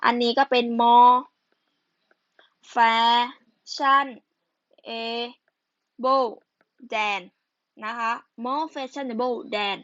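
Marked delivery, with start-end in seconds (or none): 0.8-7.2 drawn out syllable by syllable; slow dictation. Real, not acted. Teacher writing out the answer for students, slow dictation. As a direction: dictate it slowly, pausing between syllables.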